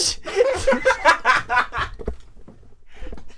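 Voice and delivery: long high breathless laughter; laughing fit. Real, not acted. Reader cracks up at her friend's joke, high breathless laughter. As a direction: dissolve into giggles.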